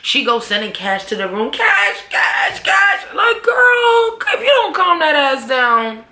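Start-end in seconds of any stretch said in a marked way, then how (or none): 1.5-3.0 panicked shouting. Retold or imitated. Lakeisha panics at door knock. 3.1-6.0 high, loud exasperated cry at the character; exasperated outburst. Real, not acted. Reacting to Lakeisha's panic.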